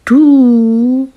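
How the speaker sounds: high, loud teasing "Du?"; girl playfully putting it to her boyfriend, high and loud; teasing question. Acted. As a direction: pitch it up and poke him with it. Girl nudges friend, finger on nose.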